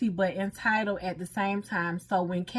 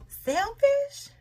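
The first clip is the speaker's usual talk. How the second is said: incredulous. Real, not acted. Wife questioning marriage viewers, incredulous high squeak. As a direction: voice jumps way up in disbelief.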